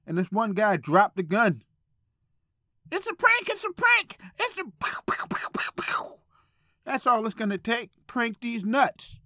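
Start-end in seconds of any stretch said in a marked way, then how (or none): 2.9-5.0 screaming it's just a prank; panicked cry. Retold or imitated. Victim shouts while pranksters shot. 5.0-6.2 mock gunshots. Imitates gunshots.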